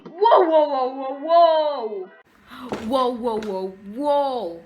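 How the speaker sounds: shocked. Real, not acted. Reacting to handcuffing scene.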